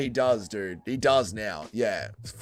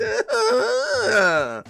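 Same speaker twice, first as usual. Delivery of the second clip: very high drawn-out cackle; hammed-up gloating laugh; mock villain cackle; 8 semitones higher than usual. Streamer pretending greed over donations, high mock cackle.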